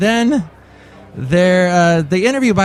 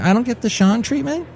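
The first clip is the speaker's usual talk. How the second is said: higher-pitched mock grievance; slighted complaint; 8 semitones higher than usual. Customer privately griping about being snubbed, slighted. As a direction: whine a little about being passed over. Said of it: "I'm kinda like chopped liver".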